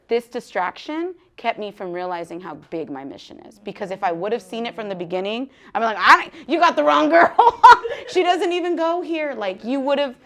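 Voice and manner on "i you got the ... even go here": higher and louder, laughing; laughing protest. Retold or imitated.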